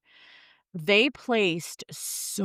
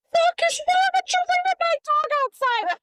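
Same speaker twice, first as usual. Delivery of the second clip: much higher, louder pretend outrage; hammed-up furious shriek at the husband; furious yell; 5 dB louder than usual. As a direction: scream at him over the dog. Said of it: "I'd be like".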